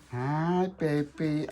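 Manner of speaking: normal talking voice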